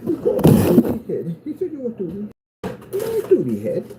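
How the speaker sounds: high-pitched, put-on whiny taunt; mock childish outrage. Vlogger mimicking name-calling with haters, mock childish outrage. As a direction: squeal it like an offended little kid.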